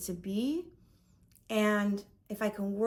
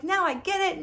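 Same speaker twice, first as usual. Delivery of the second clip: excited realization; 9 semitones higher, 4 dB louder than usual. Retold or imitated. Elated realization, quoting herself.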